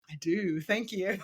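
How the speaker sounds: small, softened mock-polite answer; retold sheepish thanks, dropped in volume; embarrassed reply. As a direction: answer quietly, as if mortified. Retold embarrassed reply to former students.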